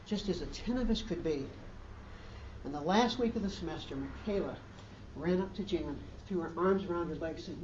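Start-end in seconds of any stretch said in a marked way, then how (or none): none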